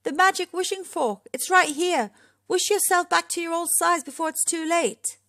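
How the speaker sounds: high, urgent calling; urgent shout. A narrator's reading. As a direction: call out a pressing warning. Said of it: "his friends all shouted together".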